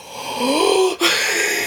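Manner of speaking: big, high, drawn-in gasp; exaggerated gasp of realisation; shocked gasp. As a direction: suck in air in sudden recognition. Retold moment of recognition.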